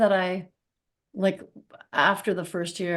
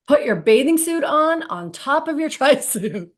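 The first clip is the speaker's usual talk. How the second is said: higher, louder barked instruction of a coach; coach's loud order; 7 semitones higher, 4 dB louder than usual. Retold or imitated. Coach ordering the swimmer before the race, loud and urgent. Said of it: "And he's like".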